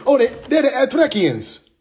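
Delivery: high, laughing mock voice of clueless outsiders; hammed-up high voice guessing group names. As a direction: squeak out the names like a giggling know-it-all. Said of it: "They say".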